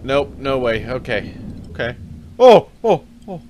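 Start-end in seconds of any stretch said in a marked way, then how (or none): 2.4-3.4 sharp, loud, high yelps of shock; real jolt of surprise, loud and high; startled yelps. Real, not acted. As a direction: yelp like something grabbed you. Sudden scare while playing.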